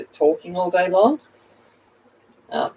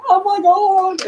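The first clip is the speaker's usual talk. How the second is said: mock students' shock; 13 semitones higher, slower than usual. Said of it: "they're just like".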